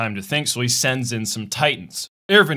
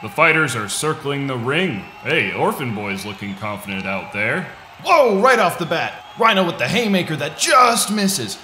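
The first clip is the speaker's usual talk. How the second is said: announcer voice; 7 semitones higher than usual. Pretend. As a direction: call the fight like a hyped announcer. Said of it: "I'm gonna need my announcer voice again".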